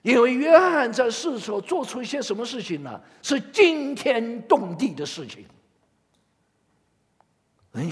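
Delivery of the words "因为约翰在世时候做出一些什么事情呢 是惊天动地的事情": preaching at full force. Acted. Pastor stressing John's mighty deeds to the church, loud and emphatic. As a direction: drive the point home to the congregation.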